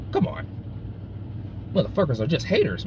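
ordinary delivery

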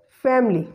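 "family" as two syllables, FAM-lee; schwa after M deleted. Shown as a demonstration. Teacher showing the silent schwa, "family" said as FAM-lee. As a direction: run M straight into L in "family". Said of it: "The word will be pronounced as".

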